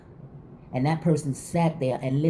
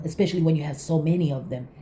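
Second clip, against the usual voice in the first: normal talking voice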